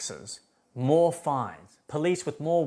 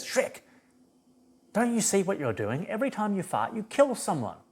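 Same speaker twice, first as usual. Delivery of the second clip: higher, pleading Donkey; 9 semitones higher than usual. Retold or imitated. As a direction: urge Shrek to see the truth. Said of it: "Donkey says".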